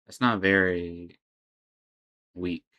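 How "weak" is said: normal talking voice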